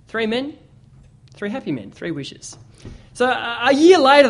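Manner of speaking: ordinary delivery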